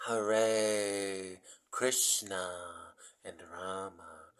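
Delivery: very slow, each syllable stretched; really slowly. Shown as a demonstration. Teaching dear souls the names, said really slowly. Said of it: "I'll say the three names really slowly".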